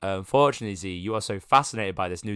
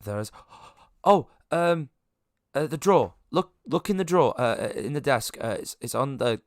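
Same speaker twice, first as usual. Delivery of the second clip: urgent directions; 6 semitones higher, 6 dB louder than usual. Acted. NPC urgently directing to drawer.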